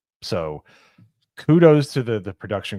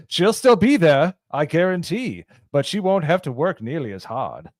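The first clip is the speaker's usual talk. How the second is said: high, loud retro newsreel voice; hammed-up fifties announcer; 7 semitones higher, 5 dB louder than usual. Mock retro announcer continued.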